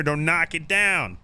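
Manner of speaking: usual speaking voice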